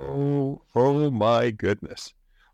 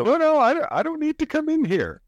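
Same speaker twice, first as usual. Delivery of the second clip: much higher mock voice. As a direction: pitch way up, playfully. High mock voice after joke.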